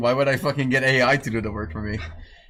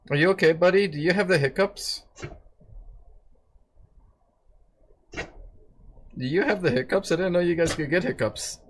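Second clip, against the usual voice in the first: higher, tender pet talk; talking to the dog; 4 semitones higher, 3 dB quieter than usual. Real, not acted. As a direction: coo at the dog gently. Asking hiccuping dog.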